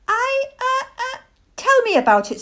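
high, faltering little voice; squeaky, stammering owlet; hesitant owlet. A narrator's reading. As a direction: stumble over it like an unsure child. Owlet hesitating, unsure about dark.

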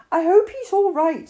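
a hint of an anxious little boy; Christopher Robin. A narrator's reading.

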